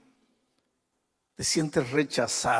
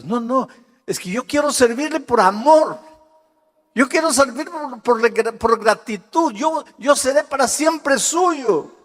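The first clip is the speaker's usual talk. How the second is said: impassioned plea; 11 semitones higher, 8 dB louder than usual. Retold or imitated. Grateful slave pleads with master.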